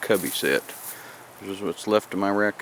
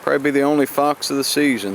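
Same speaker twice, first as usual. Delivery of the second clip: shouting over wind; 5 semitones higher, 6 dB louder, faster than usual. Real, not acted. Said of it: "You can hear me over the wind".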